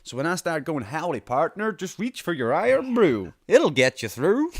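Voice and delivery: hammed-up howdy partner voice; cowboy accent. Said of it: "my terrible accent".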